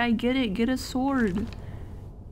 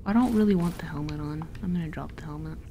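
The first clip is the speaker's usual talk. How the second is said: ordinary delivery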